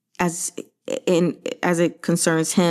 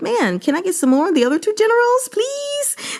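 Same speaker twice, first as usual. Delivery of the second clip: pleading whine. Retold or imitated. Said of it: "I'm like".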